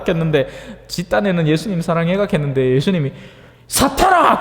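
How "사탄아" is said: sharp rebuke. Retold or imitated. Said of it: "개사했긴 해".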